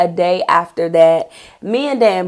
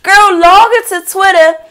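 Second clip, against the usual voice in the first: gleeful; 8 semitones higher, 4 dB louder than usual. Real, not acted. Gleeful hacking moment.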